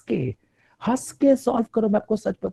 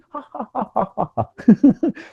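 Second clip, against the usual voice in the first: loud, higher forced laugh; fake laugh; 4 semitones higher, 6 dB louder than usual. Shown as a demonstration. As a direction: demonstrate an obviously fake laugh. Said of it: "fake हंसी, झूठी". (झूठी ज़ोरदार हँसी)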